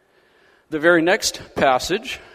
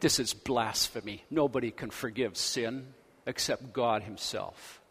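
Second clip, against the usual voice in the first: hushed, lower, disapproving mutter; muttered whisper; 4 semitones lower, 8 dB quieter than usual. Retold or imitated. Religious critics grumbling quietly in the background, hushed. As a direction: grumble it under your breath like a scandalised critic. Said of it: "whisper, whisper, whisper".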